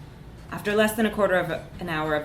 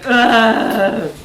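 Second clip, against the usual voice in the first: loud strangled groan of fury; frustrated rage grunt; 4 semitones higher, 11 dB louder than usual. A narrator's reading. Narrator voicing her frustrated rage as a loud ugh. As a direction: let out a furious guttural ugh. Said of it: "sounded like".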